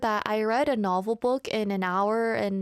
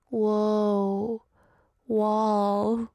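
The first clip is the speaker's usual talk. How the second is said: drawn-out, dazed musing; dazed wonder. Retold or imitated. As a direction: act out zoning out at a wall. Acting dazed staring at wall.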